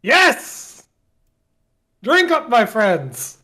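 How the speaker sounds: high, loud drawn-out cheer; rally the room to drink; jubilant shout. Acted. Morgan answering the kobold's toast, jubilant shout.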